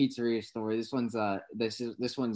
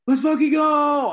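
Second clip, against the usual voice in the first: high, loud, slow shout of joy; a friend's text, read out as a victory shout; excited text read out; 10 dB louder, slower than usual. Retold or imitated. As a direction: belt it out like a cheering fan.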